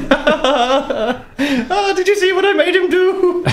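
giggling. Acted. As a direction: giggle over your prank. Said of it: "started giggling about this".

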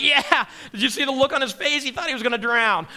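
a loud, chuckling aside; joking Jesus. Acted. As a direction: share the joke with the guys. Jesus joking about Peter.

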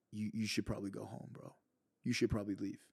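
low, hushed imitation of a guard; soft-spoken advice, retold; discreet quiet warning. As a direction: drop your voice, imitating a cautious guard. Voices security guard's discreet advice.

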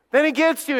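ordinary talk

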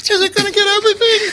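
high, loud, fast panicked hero; action movie panic. Pretend. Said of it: "nineties action movie".

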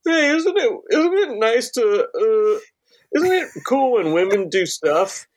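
very high mocking sitcom voice; mock cheesy comedian. Friend mocking old-style comedians, high hammed-up voice. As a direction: ham up a corny old joke.

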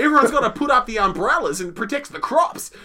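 put-on high, loud stage Australian accent; broad Australian accent. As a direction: cry it out in hammed-up broad Australian. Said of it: "broad, almost pretend Australian accent".

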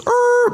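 high screech of tires spinning. Retold or imitated. Describing her car speeding off, high tire screech. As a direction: mimic a car peeling off.

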